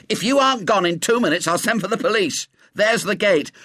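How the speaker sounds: fast, high-pitched threat; furious ultimatum. Acted. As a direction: cut in and order the intruder out. Warden cuts in, orders boy out.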